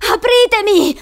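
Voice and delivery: high, loud cry for help; desperate yell. Acted. Boy shouting to woman through door, desperate yell. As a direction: yell to be let out. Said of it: "urlò Douglas".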